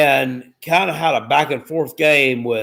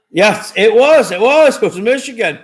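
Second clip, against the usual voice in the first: excited yes; 6 semitones higher, 5 dB louder than usual. Real, not acted. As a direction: cheer the right answer. Excited confirming trivia answer.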